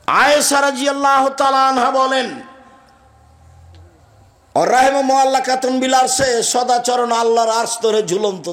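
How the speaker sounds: high, sustained hadith declamation; impassioned preaching. Acted. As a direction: proclaim it on a high, ringing monotone. Preaches hadith at full force.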